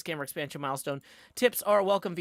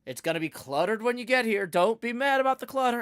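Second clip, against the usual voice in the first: higher, louder whiny protest; exasperated; 8 semitones higher, 5 dB louder than usual. Retold or imitated. Daughter protesting to parents about early arrival, exasperated. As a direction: playfully complain to the parents. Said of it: "and I'll tell them".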